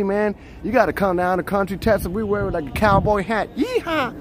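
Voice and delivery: hammed-up Texas accent ending in a yeehaw. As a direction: play a Texan cowboy bragging about home. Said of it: "what about the Texas accent?".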